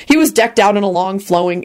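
usual speaking voice

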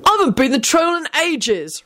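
high-pitched, mildly heated complaint; high, peeved whine; hotly indignant. A narrator's reading. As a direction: protest as if unfairly passed over. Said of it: "Ron said hotly".

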